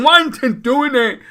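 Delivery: high, loud frustrated complaint; mock-exasperated cry of bafflement; exasperated beginner. New builder puzzling over a failed coil, hammed-up exasperation.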